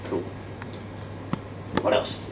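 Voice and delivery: usual speaking voice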